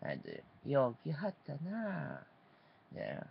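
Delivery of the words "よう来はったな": higher-pitched Kyoto-ben lilt; put-on Kyoto dialect greeting; fake Kyoto accent. Shown as a demonstration. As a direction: show how Kyoto people would say it. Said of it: "fake accent, uh, Kyoto language".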